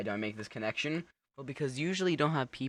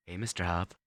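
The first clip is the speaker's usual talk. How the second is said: ordinary delivery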